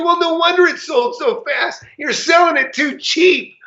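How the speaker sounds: retelling an angry jab at the agent; mad. Retold or imitated. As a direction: scold him for underpricing it. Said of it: "I was mad".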